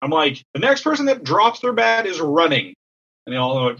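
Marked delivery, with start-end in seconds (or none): 0.5-2.7 mock yell with raised pitch; retold coach's yell. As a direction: imitate a coach calling down the line. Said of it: "I just yell".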